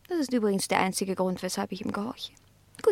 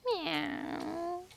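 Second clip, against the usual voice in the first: a quiet, high cat mew; cat mewing softly at the dog; soft meow; 5 semitones higher, 11 dB quieter than usual. Acted. Cat meows.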